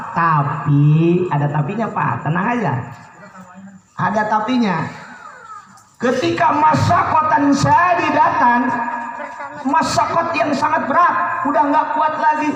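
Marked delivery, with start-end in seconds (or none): 6.0-12.5 recited ruling. Acted. Recited Arabic clause.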